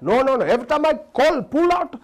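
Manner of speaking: put-on high, loud insisting Indian-accented voice; mock Indian teacher. Indian teacher insisting to the boy, loud high accent. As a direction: insist loudly with an Indian lilt.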